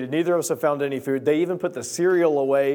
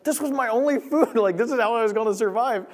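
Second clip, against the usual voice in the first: high-pitched hammed-up lament; mock despair; 7 semitones higher than usual. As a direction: wail it higher, half joking. Acted despair at locked doors.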